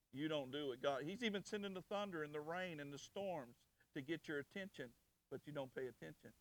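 ordinary talk, nothing marked

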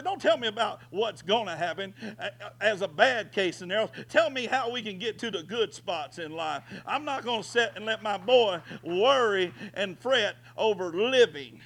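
high, loud preacher's defiance; rising to a loud defiant cry; fiery preaching. Real, not acted. Preacher retelling his stand to the doctors, loud defiance.

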